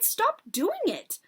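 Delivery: high, drawn-out protest; slow, high-pitched plea to stop; crying out. A narrator's reading. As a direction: stretch the words in protest. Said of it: "I cried".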